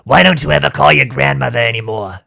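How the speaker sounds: put-on whiny nasal old lady voice; Long Island granny. Shown as a demonstration. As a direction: nag like a Long Island grandma. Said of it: "My Long Island granny".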